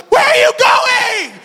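high, drawn-out shout after someone leaving; exasperated high yell; frantic call-out. Retold or imitated. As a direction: shout it slowly, pitch way up. Calling after doctor walking away.

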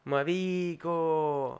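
marico said very slowly and fully, each syllable clear; carefully said. Shown as a demonstration. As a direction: draw out "Marico" slowly and precisely, quietly. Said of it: "quiso decir marico".

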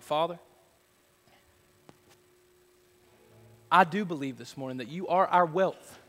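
building from calm to loud, lifted praise; fervent pastoral prayer. Acted. Pastor praying over the congregation, swelling from calm to fervent. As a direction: pour it out to God, rising.

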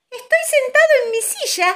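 high, loud little-bird voice; excited chick. A narrator's reading. Young bird at the table in its own chair, excited. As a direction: pipe up as a tiny chick.

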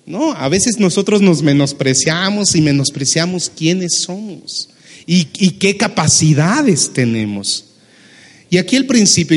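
ordinary delivery

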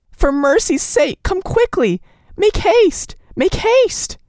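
high, urgent begging, building; desperate pleading. A narrator's reading. Nellie begging the doctor to come, high and desperate. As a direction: beg him to hurry.

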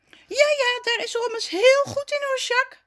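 very high-pitched little figure voice; eager agreeing. A narrator's reading. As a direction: chime in brightly to back a friend. Zaza chimes in, comforting Jacques. (instemmend, met heel hoog stemmetje)

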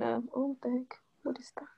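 sudden startled whisper; hushed, surprised whisper. Real, not acted. Sudden hushed aside at something.

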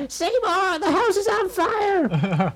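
put-on very high, slow old mother's wail; the mum. Skinner's mother calling from upstairs, very high and slow. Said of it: "you got the mum in the background".

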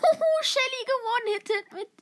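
high, loud, laughing reaction; excited laughing. Real, not acted. Gamer reacting to a hit on Shelly, excited laughing. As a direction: squeal it through a laugh.

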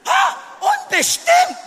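very high, slowed exclamation of disbelief; high-pitched mock shock; incredulous outcry. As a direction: cry it out high, aghast at the word. Mock outcry at the word unbestimmt.

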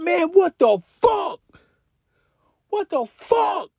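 high, slow put-on voice of stunned friends; mock disbelief. Collier and friends reacting to the theft, slow high mock disbelief. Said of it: "they're like".

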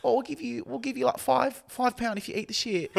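high-pitched daring skit voice; mock goading. Bystanders dare her for five pounds, mock goading. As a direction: egg her on in a high put-on voice.

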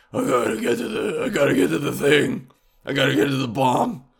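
put-on superhero voice; Batman. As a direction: play a mock caped crusader rushing off. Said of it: "and he's like".

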